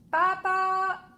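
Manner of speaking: high, loud call; boy calling papa yet again; child shouting. A narrator's reading. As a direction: yell it from bed. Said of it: "crie Alphonse".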